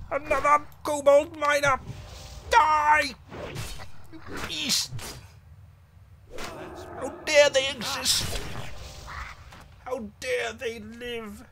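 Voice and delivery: high, loud outraged cries, slowing at the end; furious shouting. Acted. Paladin charges the kobold miners in outrage. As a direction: rage at the creatures as you cut them down.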